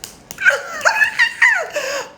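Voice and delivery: shrieking high laugh; real laughter at the story. Real, not acted. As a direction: burst out in a squealing laugh. Amused by story.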